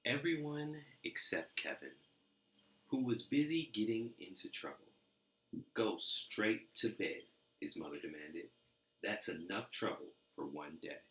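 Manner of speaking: lively storybook reading; animated read-aloud for kids; story narration. A narrator's reading. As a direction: read it like a bedtime picture book. Reading picture book aloud.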